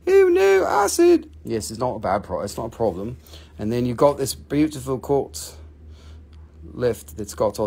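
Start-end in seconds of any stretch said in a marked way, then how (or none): 0.1-1.3 high, hammed-up cry of fake panic; mock alarm. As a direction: squeal it like a cartoon victim, all in jest.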